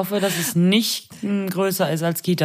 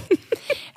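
breathy, voiceless giggle held back; stifled laugh, almost no voice; silent laugh; 14 semitones higher, 3 dB quieter than usual. Real, not acted. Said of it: "ich hab so still gelacht".

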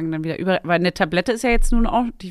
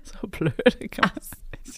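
laughing; 5 dB quieter than usual. Real, not acted. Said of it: "Was war das denn?".

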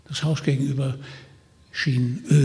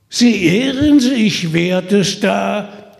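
louder, higher, slow mocking shout; 6 semitones higher, 8 dB louder, slower than usual. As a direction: shout it across with mock courtesy. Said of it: "schrie jener herüber". (spöttisch herübergeschrien)

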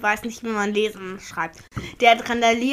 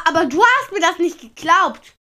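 louder and higher, pressing the point on hast; insistent loud protest; 6 semitones higher, 7 dB louder than usual. Real, not acted. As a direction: raise your voice to insist she didn't believe you. Child insists after "Hallo, aber.

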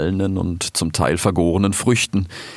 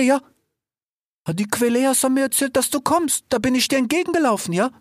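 high, chatty, breezy youth; carefree young fox; 14 semitones higher than usual. Acted. Kenneth reassuring his father, high and carefree. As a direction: rattle it off cheerfully.

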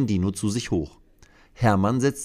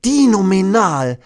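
hoher, lauter, langsamer Jubel; begeisterter Dino; 9 semitones higher, 7 dB louder than usual. Acted. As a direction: dehne das Wort vor Begeisterung. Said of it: "denkt Hermann". (hoher, begeisterter Dinojubel)

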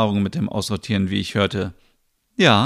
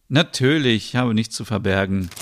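plain reading voice

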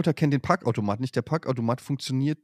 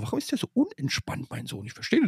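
a hint of mom's wounded self-talk; mother muttering; 4 dB quieter than usual. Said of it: "dann ist der immer so".

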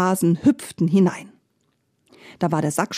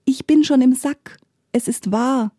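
higher, little princess voice, not hushed; lightly voiced small voice; 6 semitones higher than usual. A narrator's reading. Hidden princess answers from the sack in a small voice. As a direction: pipe up in a small high voice. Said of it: "eine leise Stimme".

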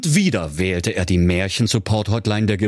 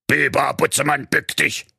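heiser und etwas höher geschmettert; hoarse belting; 6 semitones higher than usual. Acted. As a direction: raue Rockröhre schmettern. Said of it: "mit heiserer Röhre schmetterte". (heisere Röhre)

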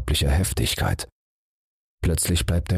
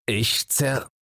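higher, breaking off after "zer-"; broken restart; 7 semitones higher than usual. Acted. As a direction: start and stall on "zer-". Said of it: "Immer von neuem beginnt er". (Holzer setzt hoch an und bricht ab)

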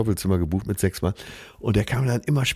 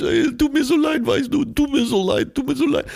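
high, pleading apology in broken German. Retold or imitated. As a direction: imitate a foreigner's broken German, begging sorry. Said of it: "im gebrochenen Deutsch".

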